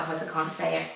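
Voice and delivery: ordinary talk, nothing marked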